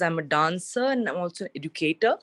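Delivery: ordinary talk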